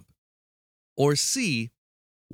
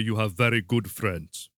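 hammy Russian celebrity sign-off; Russian accent; 3 semitones lower than usual. Acted. Host closes a paid birthday video, Russian accent. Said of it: "I wanna hear Russian".

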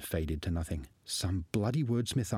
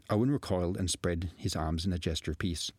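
ordinary delivery

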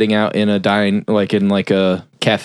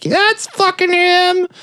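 very high, drawn-out mock outcry; imitated accusing cry; slower than usual. Canadian pointing out his attacker to police, high mock outcry. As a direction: squeal the accusation as the aggrieved victim, stretched out.

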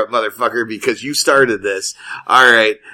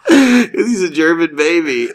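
laughing through the words; cracking up at the punchline; 6 semitones higher, 4 dB louder, slower than usual. Real, not acted. Teller laughing at his own German baby joke.

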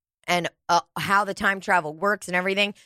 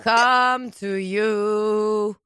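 very slow; much slower than usual. Pretend. Drawn-out joke voice.

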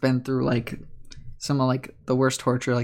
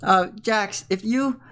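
higher, coaxing character voice; earnest young Riley; 5 semitones higher than usual. Acted. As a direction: kindly propose a compromise to a friend.

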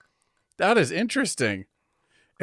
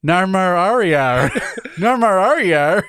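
exaggerated Australian drawl, vowels smeared wide; mock Australian accent; 4 semitones higher, 4 dB louder, slower than usual. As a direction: ham up the Australian into near-gibberish. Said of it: "If I was an Australian interviewing".

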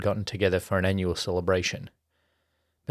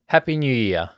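louder, higher cheer; yelled greeting; 4 semitones higher, 6 dB louder than usual. A narrator's reading. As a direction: cheer it out together. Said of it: "they all yelled in unison".

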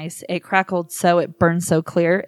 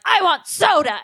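put-on high whiny kid voice; whiny demand; 11 semitones higher than usual. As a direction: whine it like a child. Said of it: "I'm like".

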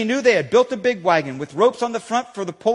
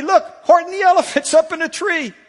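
higher, louder jeering shout; gleeful shout; 9 semitones higher, 4 dB louder than usual. Acted. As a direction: friends shouting in delight at the sight. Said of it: "they shouted with glee".

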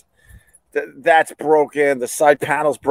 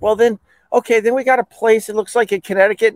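much higher-pitched helpful patter; imitated insurance rep; 10 semitones higher, faster than usual. Insurance rep offering caller a distant shop, higher voice. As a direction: voice the rep higher than yourself.

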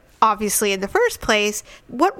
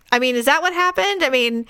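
usual speaking voice

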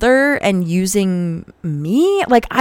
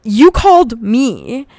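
high, loud incredulous yell; retold furious outburst; reenacted screaming; 8 semitones higher, 6 dB louder than usual. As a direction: fire back indignantly at an ex, pitched up. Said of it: "and I screamed at him".

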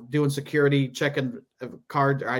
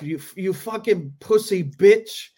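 louder, higher angry imitation in a put-on Middle Eastern accent; Middle Eastern-sounding accent; 5 semitones higher, 5 dB louder than usual. Italian guy goes off on comic, mock-angry Middle Eastern-sounding accent. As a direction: spit insults in a rough Middle Eastern accent. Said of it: "when I do his accent, it's gonna sound Middle Eastern".

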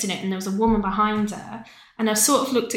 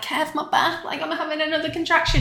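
higher, urgent plea to the partner; half-playful strained plea, pitched up; mock pained plea; 7 semitones higher than usual. Telling her husband mid-labor, raised pleading voice.

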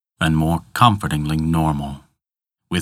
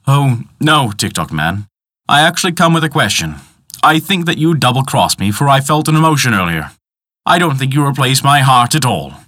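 put-on higher, faster, suspicious young man; high accusing voice; 7 semitones higher, 5 dB louder than usual. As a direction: accuse him, quick and pointed. Peter confronts the tick-tock man.

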